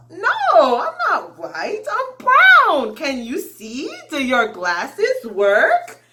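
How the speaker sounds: high, loud playful mock protest, slowed on the questions; joking protest. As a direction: tease the pupil with exaggerated high outrage. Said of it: "kind of joking with the student".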